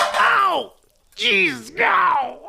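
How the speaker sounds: sharp high yelps of pain. Acted. Thorne burning his hand on the coffee pot, yelping high. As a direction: yelp as the hot pot burns you.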